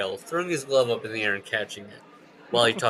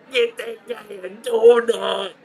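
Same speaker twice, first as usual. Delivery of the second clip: slow, high, loud put-on heckler; heckler in crowd; 11 semitones higher, 8 dB louder, much slower than usual. Fan in the stands mocking the slow player, loud and slow. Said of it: "one guy goes".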